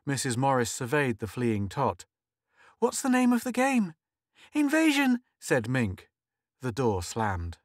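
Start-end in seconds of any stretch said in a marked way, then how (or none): 2.8-3.9 mother calling out. Acted. Mother calling after child. 4.5-5.2 put-on high little girl. Girl answering mother.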